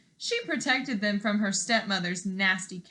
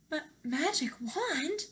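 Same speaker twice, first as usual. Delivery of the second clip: gasping; 6 semitones higher, 4 dB quieter than usual. Acted. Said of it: "gasped Cinderella".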